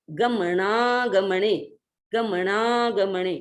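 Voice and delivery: गमनागमने slow, with णा joined to the next ग; गमनागमने slow, syllable by syllable. Shown as a demonstration. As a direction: link णा and ग in गमनागमने. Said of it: "उस णा के उच्चारण को हम ग के साथ इधर जोड़ देंगे".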